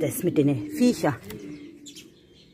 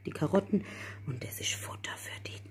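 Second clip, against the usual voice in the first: soft whisper so the cats stay calm; 11 dB quieter than usual. Real, not acted. Whispering to herself beside the cat feeding spot. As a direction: breathe the words, no voice.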